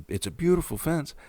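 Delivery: high-pitched gushing imitation of "beautiful fence"; gushing quote. Quoting the neighbor's exact words about the fence, high and gushing. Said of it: "that was the exact words he said".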